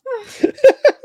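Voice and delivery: sudden loud burst of laughter, high. Real, not acted. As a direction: crack up right into the mic. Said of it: "I just laughed right into the microphone".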